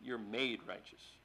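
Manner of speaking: "made" slow and low, set against "become"; stress on made. Shown as a demonstration. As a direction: quietly press "made". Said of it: "You don't become righteous".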